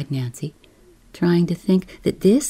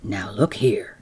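lower, confiding skipper voice; low man's voice; 9 semitones lower, 5 dB quieter than usual. Acted. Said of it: "says he".

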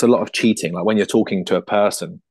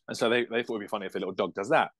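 usual speaking voice

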